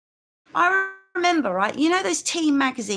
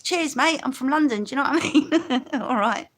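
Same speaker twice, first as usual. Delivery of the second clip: a playful, put-on London accent, a touch louder; mock London accent; 3 semitones higher, 4 dB louder than usual. Answering a comment about her accent in a mock London accent. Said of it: "Well, your accent is simply marvellous".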